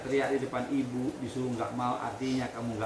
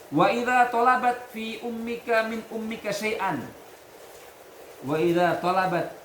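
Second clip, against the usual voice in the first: slow, loud, higher reading chant; Arabic recitation; 10 semitones higher, 6 dB louder than usual. Acted. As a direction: intone the Arabic text slowly for the class. Teacher reads Arabic text aloud.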